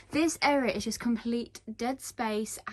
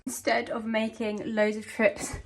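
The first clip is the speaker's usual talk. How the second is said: ordinary talk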